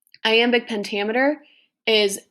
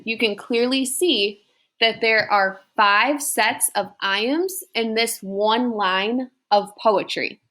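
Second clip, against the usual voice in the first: ordinary delivery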